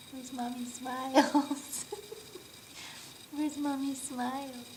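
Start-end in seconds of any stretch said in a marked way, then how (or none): none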